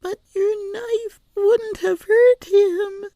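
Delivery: put-on high, slow crying child; tearful protest. A narrator's reading. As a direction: protest through tears. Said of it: "protested the little girl".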